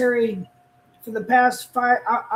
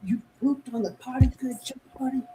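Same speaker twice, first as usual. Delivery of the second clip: soft, proud dad praise; potty training praise; 5 dB quieter, faster than usual. Real, not acted. Praising toddler for potty use.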